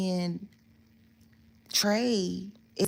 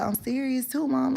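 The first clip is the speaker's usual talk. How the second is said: much higher-pitched mock protest; imitated insistent son; 8 semitones higher than usual. Son assuring mom he is serious, imitated insistence. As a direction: insist in a raised, put-on voice.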